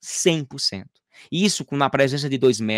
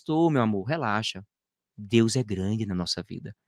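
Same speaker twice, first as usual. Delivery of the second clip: lower, softer and slower, soothing; calm reassurance; 5 semitones lower, 5 dB quieter, slower than usual. Retold or imitated. Husband calming his frightened wife over the baby's leg, soft and slow. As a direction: settle her fears gently, dropping the voice.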